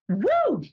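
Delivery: high, drawn-out cheer; celebratory woo. Real, not acted. Host celebrating a global show, excited whoop. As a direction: whoop in high-pitched triumph.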